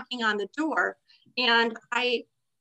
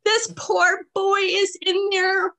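laugh-crying; 8 semitones higher, 5 dB louder, slower than usual. Real, not acted. Laughing while retelling.

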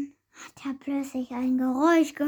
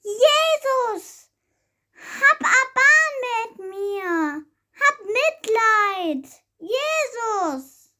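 desperate calling out; 4 semitones higher, much slower than usual. Acted. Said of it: "Bartimäus Ruf".

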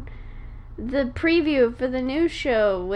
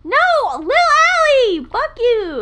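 yelling; 9 semitones higher, 8 dB louder, slower than usual. Real, not acted. Insisting on her show name.

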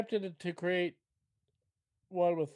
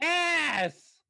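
shouted; 12 semitones higher, 8 dB louder than usual. Acted. Climax of mocking.

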